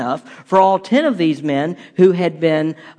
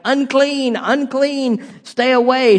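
high, slow mock call of unclean; a hammed-up, mild warning shout; leper's warning cry; 6 semitones higher, slower than usual. Townsfolk mimicking a leper warning people away, high slow cry. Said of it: "shouting weird things".

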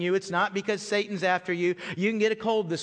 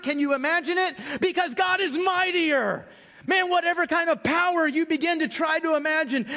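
higher and louder, driving the point home; preaching at full force; 8 semitones higher, 3 dB louder than usual. Acted. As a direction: build the congregation up to a peak. Preaching climax on God's might.

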